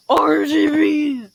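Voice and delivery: loud, drawn-out character yell. Shown as a demonstration. Demonstrating how this dude sounds, yelling. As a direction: yell it out long and hard. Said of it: "He sounds like me yelling".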